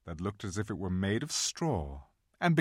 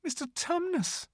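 very high, softish plea; distressed little girl calling his name; great distress; 4 dB quieter than usual. A narrator's reading. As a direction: plead anxiously in a high child's voice. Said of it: "said Lucy in great distress".

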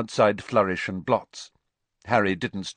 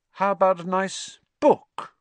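mock-innocent tease, much higher; innocently; 11 semitones higher than usual. A narrator's reading. Ron teases Hermione about books, feigned innocence. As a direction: ask it all innocence, teasing. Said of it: "said Ron innocently".

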